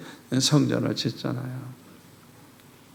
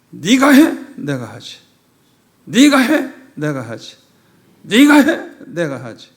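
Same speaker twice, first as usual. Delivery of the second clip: imitated divine scolding; 8 dB louder than usual. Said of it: "세 번이나 반복해가지고 야단을 치시는".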